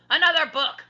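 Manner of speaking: high, loud pirate roar. A narrator's reading. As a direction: roar it in disgust. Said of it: "roared Captain Bones".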